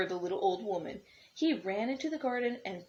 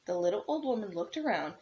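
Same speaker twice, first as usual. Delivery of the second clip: usual speaking voice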